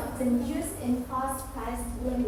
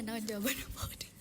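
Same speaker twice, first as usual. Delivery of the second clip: whisper; 5 semitones lower, 13 dB quieter, much faster than usual. Real, not acted. Asking classmates quietly.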